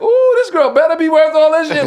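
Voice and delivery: very high, loud hammed-up mother; funny mother. Son imitates his mother sizing up his date, high and loud. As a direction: wail it out in a mock motherly high voice. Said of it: "my mother mad funny".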